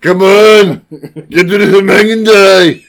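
put-on loud, high action-hero shout in Austrian accent; Schwarzenegger impression. Co-host spoofing an action-movie line, mock Schwarzenegger shout. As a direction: bellow it like an Austrian action star. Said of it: "Oh, that's Schwarzenegger".

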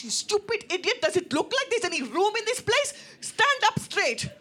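high, loud shrieking grandmother; furious scolding. Retold or imitated. Grandmother to concussed uncle, angrily scolding at top pitch. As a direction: tear into him, voice pitched right up.

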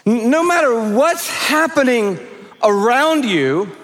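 ordinary talk

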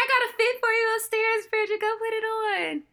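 a high, sing-song mum calling her daughter down; lightly voiced British mum with a pushy offer; mother calling upstairs. Mother urging Bridget upstairs to change, high sing-song call. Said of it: "She was like".

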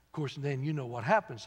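usual speaking voice

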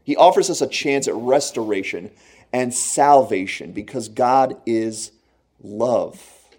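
ordinary delivery

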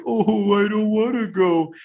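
put-on high whiny child voice; mock toddler whine; mocking a little kid. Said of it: "you might be like".

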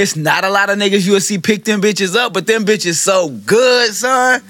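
loud hyped raving; frat bro. Real, not acted. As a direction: rave about it, pitched high and loud. Hyping up Toblerones, shouting.

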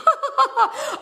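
high, loud giggling burst; real laugh of embarrassment; squeaky embarrassed giggle. Real, not acted. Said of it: "I got so embarrassed".

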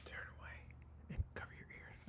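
secret spoiler whisper. Pretend. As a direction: whisper it like a warning. Hiding a spoiler from listener.

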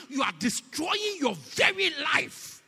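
slow, loud, high shout; preaching shout. Acted. Preacher shouting a warning to the congregation. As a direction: hammer it home, slowly.

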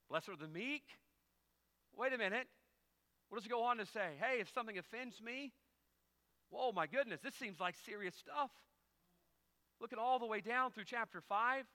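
loud, high mock complaint; hammed-up indignation at scripture; mock indignant. Mock objections to Beatitudes.

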